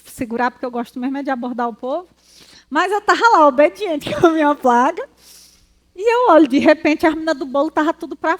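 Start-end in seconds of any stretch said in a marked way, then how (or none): none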